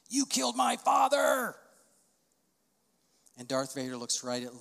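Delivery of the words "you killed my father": high, loud, slow anguished shout; Luke's shout. Retold or imitated. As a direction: hurl the accusation at him. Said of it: "he says".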